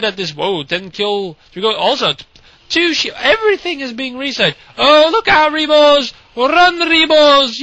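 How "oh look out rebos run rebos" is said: high-pitched excited commentator's cry; urgent warning. Real, not acted. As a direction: call out a warning to the units. Warns units during live match.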